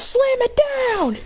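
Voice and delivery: high, drawn-out attack cry; battle command. Acted. Trainer ordering Lucario's slam, long high battle cry.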